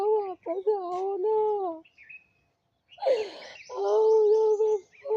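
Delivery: slow, tearful begging, voice rising and breaking; real crying, drawn-out call to papa; crying plea. Real, not acted. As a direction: sob it out, pleading slowly. Pleading with dead father at grave.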